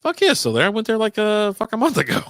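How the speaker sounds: high, loud, enthusiastic confirmation; excited. Real, not acted. Telling a friend the bar is still open, excited. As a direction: fire it back loud and high, really excited.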